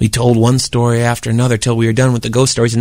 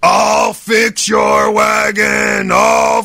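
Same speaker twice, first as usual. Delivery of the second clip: monster threat; 7 semitones higher, much slower than usual. Retold or imitated. Bill scaring Glenn in the tent, slow bellow. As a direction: roar it like an angry ghoul. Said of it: "The guy started to say".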